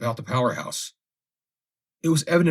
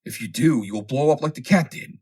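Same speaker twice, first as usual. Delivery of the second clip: higher and louder, pressing the danger home; urgent warning; 5 semitones higher, 5 dB louder than usual. A narrator's reading. Fosdick warns Stetzel they are charged, loud and urgent. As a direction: keep calling out the warning with force. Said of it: "shouted Mister Fosdick warningly".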